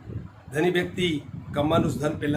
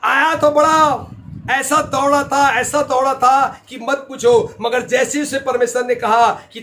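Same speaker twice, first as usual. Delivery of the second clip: higher, pressing sermon storytelling; full-force preaching; 8 semitones higher, 9 dB louder than usual. Real, not acted. Preacher telling believers of the rich man running, forceful preaching. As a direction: drive the story home to the crowd.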